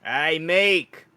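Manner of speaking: loud street hail; meerkat merchant calling. Acted. Street contact hailing Meek in an alley, calling out. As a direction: call out down an alley to a pal. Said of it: "You hear another voice".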